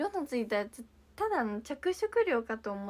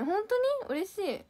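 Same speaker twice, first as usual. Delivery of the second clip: surprised; 8 semitones higher, 4 dB louder, slower than usual. Real, not acted. Fan calls her pretty.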